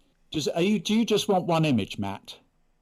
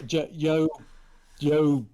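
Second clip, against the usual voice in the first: usual speaking voice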